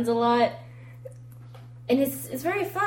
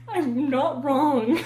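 ordinary talk, nothing marked

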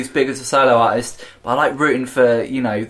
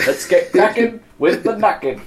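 higher put-on comic voice; Bo Selecta voice; 6 semitones higher than usual. Mocking the accent, higher comic voice. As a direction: squeak it out like a sketch puppet. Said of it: "it sounds like the fucking Bo Select".

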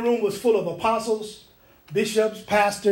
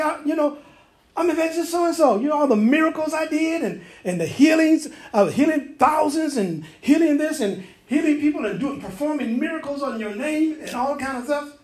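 much higher proud pleading voice; boastful evangelist; 7 semitones higher than usual. Retold or imitated. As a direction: brag about your miracles to Jesus.

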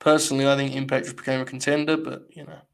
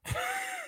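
high squeaky laugh of disbelief; disbelieving laugh; 10 dB quieter than usual. Real, not acted. Streamer watching a bad angle, high disbelieving laugh. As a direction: let out a high incredulous giggle.

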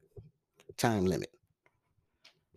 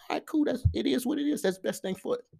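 way up high, breezy and accepting; a high-pitched shrugging reply to critics; mock high voice. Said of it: "And I'm like".

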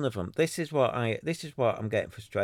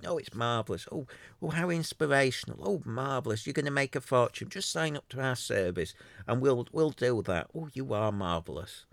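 mock brown-noser; 4 semitones higher than usual. Mocking Clubhouse flatterers gushing over a podcast, gushing mock praise. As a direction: lay the fake praise on thick, pitched up. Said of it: "and they go".

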